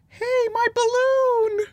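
put-on very high, wailing child voice, drawn out; shouting little kid. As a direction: cry out like a kid losing a balloon. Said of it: "is shouting".

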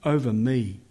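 earnest spoken prayer to the Lord. Acted.

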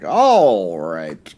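lightly voiced Italian brain talking; a hint of a shitty Italian accent; fake Italian accent. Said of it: "a shitty fake Italian accent".

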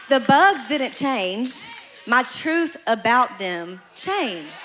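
slow, weighty preacher's emphasis; slow emphatic. Real, not acted. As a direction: land each word like a verdict. Preaching key point of sermon.

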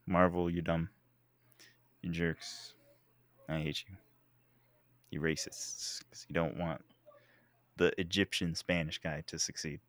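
low, hushed grumble; soft, low, playful sulk; mock-bitter muttering. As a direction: grumble insults under your breath in mock resentment. Mock grievance muttered at Marvel.

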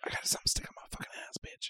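whispered, fast, secretive; quick mock whisper from the kid; whispered quote. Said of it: "he's like".

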